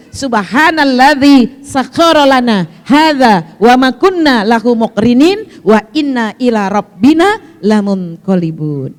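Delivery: very slow, loud, measured Arabic prayer; prayer recitation. Acted. Teacher adding another travel prayer for her congregation, slow recitation. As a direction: recite each phrase firmly, drawn out.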